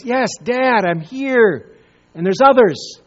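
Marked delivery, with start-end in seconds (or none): none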